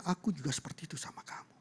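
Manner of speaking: hushed, half-whispered reassurance; tender confession. Retold or imitated. Father answering his daughter's love, tenderly hushed. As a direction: let the voice sink into a soft whisper.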